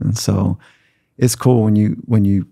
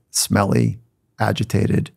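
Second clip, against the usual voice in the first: ordinary delivery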